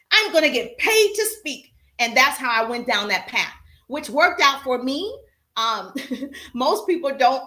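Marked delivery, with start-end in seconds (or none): 0.1-1.7 higher-pitched retold excitement; excited resolve. Speaker recalling choosing a speaking career, excited resolve. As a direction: declare it like a thrilled new discovery.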